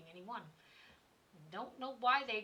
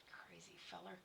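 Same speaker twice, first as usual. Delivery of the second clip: whispered aside; 3 semitones lower, 14 dB quieter than usual. Acted. Muttered aside about the boy.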